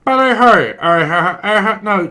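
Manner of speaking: loud, high squawk; garbled caller. Acted. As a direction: blare it down the phone unintelligibly. Caller told to speak up.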